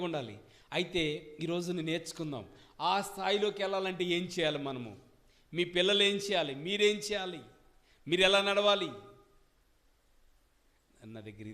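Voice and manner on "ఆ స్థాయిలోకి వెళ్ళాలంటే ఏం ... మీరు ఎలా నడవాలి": loud rising sermon questions; full-force preaching. Acted. Preacher challenging parents, full-force preaching. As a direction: build the questions up louder.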